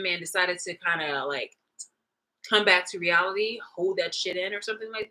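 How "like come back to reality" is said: ordinary talk, nothing marked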